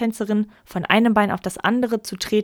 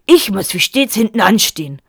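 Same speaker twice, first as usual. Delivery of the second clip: loud self-pitying grievance; wrathful; 7 dB louder than usual. A narrator's reading. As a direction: play the martyr, angrily. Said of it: "zürnte der Vater". (zürnend und beleidigt)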